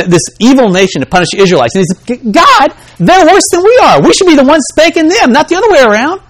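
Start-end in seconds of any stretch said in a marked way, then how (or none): 2.1-6.2 high, louder indignant protest; upset prophet. Retold or imitated. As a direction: argue with God that it's unfair. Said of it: "very upset at God".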